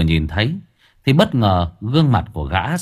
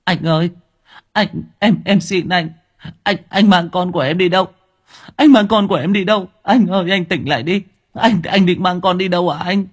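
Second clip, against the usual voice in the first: high, tearful begging to her husband; whimpering plea; 9 semitones higher than usual. Acted. Wife begging her husband not to take the child, tearful. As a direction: sob out the pleas, voice climbing. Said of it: "Cô ta mếu máo".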